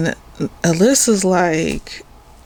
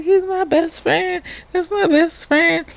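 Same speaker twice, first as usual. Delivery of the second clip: high, whiny imitation of her defending him; lightly voiced indignant friend; mock defensive; 10 semitones higher than usual. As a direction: play her clinging to him, pitched up. Alyssa defending her man.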